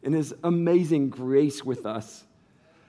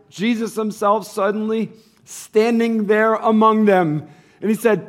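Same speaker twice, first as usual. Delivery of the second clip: scripture reading that builds louder and higher; 5 semitones higher, 6 dB louder than usual. Acted. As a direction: read the passage, climbing toward the climax. Reads scripture aloud, rising.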